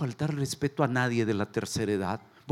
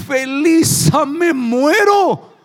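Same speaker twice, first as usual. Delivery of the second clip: high, very slow, hammed-up yell with laughter; old man's laughing shout; 14 semitones higher, 12 dB louder, much slower than usual. Grandpa with his dentures in, yelling and laughing. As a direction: cry it like a grandpa having a heart attack. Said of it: "el viejito dice".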